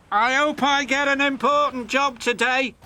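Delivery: high, loud, proud engine voice; James wheezing. A narrator's reading. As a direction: puff it out like a vain young engine. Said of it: "wheezed James".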